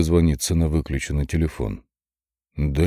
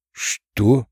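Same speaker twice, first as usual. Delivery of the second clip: stunned; 5 semitones higher than usual. Acted. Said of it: "только и смог произнести я".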